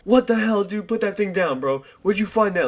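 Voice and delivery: high, loud alarmed cry at a friend; what the hell, put it down; alarmed yelling. Retold or imitated. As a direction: freak out at the machete. Stoned friends alarmed at machete.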